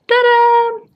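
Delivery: high, loud cheerful fanfare; excited reveal. Real, not acted. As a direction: announce it like unveiling a gift. Unveiling new book gift.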